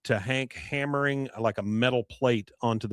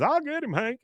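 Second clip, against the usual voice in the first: high squeaky Bill; 14 semitones higher than usual. Retold or imitated. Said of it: "Bill says".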